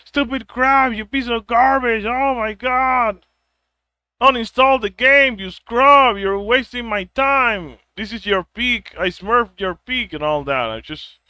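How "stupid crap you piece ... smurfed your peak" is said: high, loud mocking gamer rage; raging gamer. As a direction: yell it like a tilted teammate ranting. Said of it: "someone who's yelling at me".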